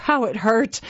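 plain reading voice

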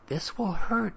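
high-pitched warning that breaks in; interrupting. A narrator's reading. Mother cuts off the strange man to warn him, interrupting in a high pitch. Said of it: "Tommy's mother interrupted".

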